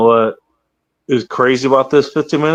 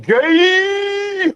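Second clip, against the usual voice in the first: high mocking squeal. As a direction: squeal it high like a teasing kid. Mocking friends' banter.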